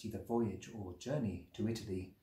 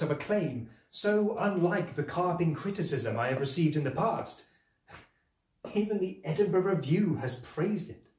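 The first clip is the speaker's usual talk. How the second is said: excited; 7 semitones higher, 8 dB louder than usual. Acted. Joy at book's acclaim.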